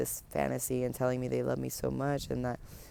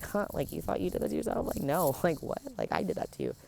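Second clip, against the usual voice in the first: casual mocking laugh; 4 semitones higher, faster than usual. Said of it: "just so casual".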